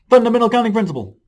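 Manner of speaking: high, fast, loud exclamation; loud title announcement. Pretend. Math teacher announcing the lesson's next topic, loud high exclamation.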